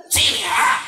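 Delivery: a touch loud, mock calling out; too-loud voice demo. Shown as a demonstration. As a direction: demonstrate a wife's over-loud call. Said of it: "suaranya terlalu keras".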